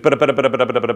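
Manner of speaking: rapid-fire "bada-bada" noise; machine-gun babble mimicking incoming alerts; imitated alert barrage. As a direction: rattle off nonsense syllables like a nonstop barrage.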